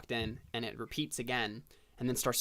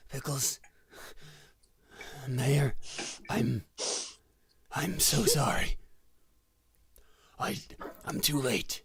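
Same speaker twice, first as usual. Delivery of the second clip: dying gasp. Acted. Dying man's last words to mayor.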